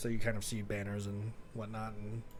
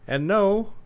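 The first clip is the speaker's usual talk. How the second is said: loud, higher menacing threat; threatening king; 6 semitones higher, 11 dB louder than usual. Acted. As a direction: warn him you will destroy him. King threatens his brother.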